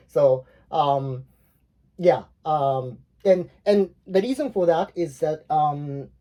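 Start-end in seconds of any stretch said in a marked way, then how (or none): none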